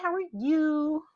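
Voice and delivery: "how are you" high and slow, in a different voice; sing-song how are you. Real, not acted. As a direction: lilt the question way up. Sing-song greeting continued.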